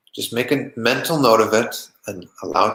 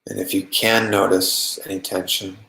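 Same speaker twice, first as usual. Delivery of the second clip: calm, even meditation voice with long pauses; unhurried, settled meditation guiding; guided meditation. Acted. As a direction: guide them gently into relaxation, leaving long silences.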